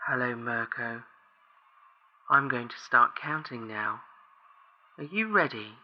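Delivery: low, soft eerie caller; unsettling voice on a bad line; creepy crackly voice. A narrator's reading. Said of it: "oddly crackly".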